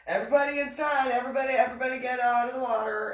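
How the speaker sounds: loud, high, rapid command to a group; shouted order. Retold or imitated. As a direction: clear everyone out urgently at full volume. Patty ordering everyone out of water.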